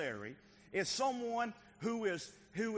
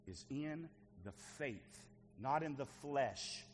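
slow emphatic; 4 semitones lower, 6 dB quieter, much slower than usual. Acted. Quoting Scripture slowly.

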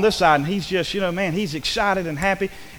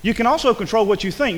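normal talking voice

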